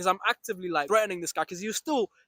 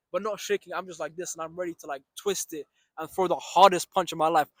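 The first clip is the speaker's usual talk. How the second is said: ordinary talk, nothing marked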